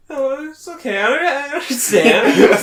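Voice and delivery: high, slow whimpering girl voice, hammed up; mock rejected girl. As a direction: play her fake-brave and hurt, overdone. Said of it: "she was like".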